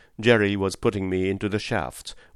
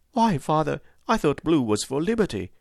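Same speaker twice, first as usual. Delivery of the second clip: put-on high young boy, indignant; a boy rushing in to tell his father; boy's voice; 7 semitones higher than usual. Harry questions his father.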